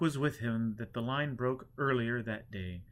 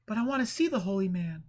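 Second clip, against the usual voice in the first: higher, insistent visitor voice; eager visitor; 9 semitones higher, 6 dB louder than usual. A narrator's reading. Visitor at the door asking for the holy man, higher. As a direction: push to see the holy man.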